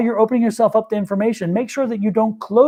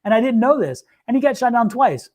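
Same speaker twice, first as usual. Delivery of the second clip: usual speaking voice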